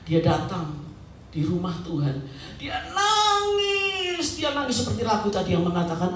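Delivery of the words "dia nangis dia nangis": hugely stretched, high "nangis"; drawn-out wail. Retold or imitated. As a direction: dramatize the weeping by holding the word long. Dramatizing Hana's weeping. (kata "nangis" dipanjangkan seperti meratap)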